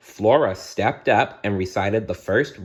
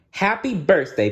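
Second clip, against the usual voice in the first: high, bright fairy greeting; 10 semitones higher than usual. Acted. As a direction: sing out the greeting like a little fairy. Fairy Merryweather greets princess.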